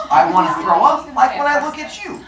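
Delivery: high-pitched revulsion; exaggerated, repulsed outburst; mock disgust. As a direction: let disgust push the voice up. Zeus disgusted in story.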